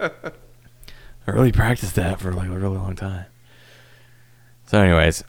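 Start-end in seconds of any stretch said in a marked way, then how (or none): 0.0-3.3 whispering. Real, not acted. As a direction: whisper it aside. Whispered aside after bit.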